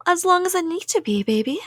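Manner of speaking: ordinary delivery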